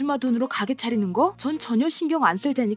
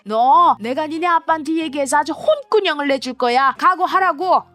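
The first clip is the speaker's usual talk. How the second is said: higher, louder stepmother threatening; furious stepmother; 5 semitones higher, 5 dB louder than usual. Acted. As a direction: threaten to tell her father.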